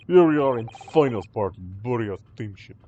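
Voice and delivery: put-on loud, proud Russian accent; guard boasting about first class, loud Russian accent; boastful Russian sailor. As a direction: show off the ship like a Russian guard. Sailor shows off the cabins.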